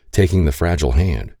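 ordinary delivery

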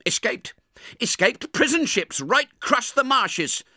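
snappish. Acted. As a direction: snap the answer impatiently. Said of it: "said snappishly".

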